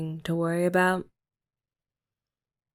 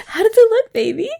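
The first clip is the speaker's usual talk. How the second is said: giddy question; 13 semitones higher, 8 dB louder than usual. Real, not acted. Asks partner after finishing, laughing.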